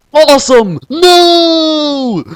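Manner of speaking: high, loud, held-out scream; anguished scream. Acted. Suzaku watching Awesome vaporized, a high anguished scream. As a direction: cry out in horror as he dies. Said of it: "screamed Suzaku".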